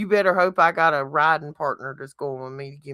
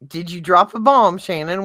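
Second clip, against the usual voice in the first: ordinary talk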